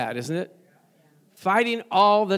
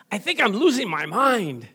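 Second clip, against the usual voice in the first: exasperated parent; 3 semitones higher than usual. Retold or imitated. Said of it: "things like".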